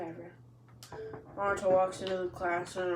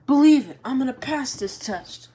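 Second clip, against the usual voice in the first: much louder, high battle cry; boy yelling his vow at the teacher, much louder; fired-up shout; 7 semitones higher, 15 dB louder than usual. Acted. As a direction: shout it like a promise. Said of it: "Naruto looks at Kakashi like".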